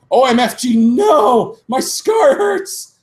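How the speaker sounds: very high, loud, slow scream; hammed-up pained scream. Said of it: "he started to scream".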